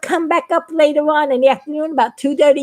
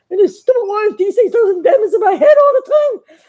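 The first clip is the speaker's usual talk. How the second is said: exasperated complaint; 7 semitones higher, 5 dB louder than usual. Acted. Biggie complaining, voice rising.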